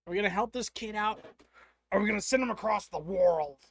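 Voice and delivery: loud, rising, excited call-out; rally the viewers at full voice; big hype yell at chat; hyped shout to chat. Real, not acted. Hyping chat to help the kid.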